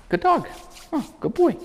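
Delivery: high, singsong praise for the dog; bright high-pitched dog praise. Real, not acted. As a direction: squeal it like praising a puppy.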